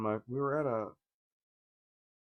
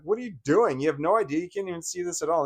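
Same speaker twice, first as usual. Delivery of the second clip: retold outburst, higher and louder; indignant retort; 6 semitones higher, 5 dB louder, faster than usual. Child confronts rude mother at the table, indignant. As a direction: fire back in higher-pitched exasperation.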